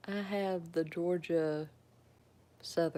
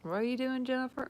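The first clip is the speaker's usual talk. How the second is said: teased-about question; 7 semitones higher, faster than usual. Retold or imitated. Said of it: "they pick on me about that".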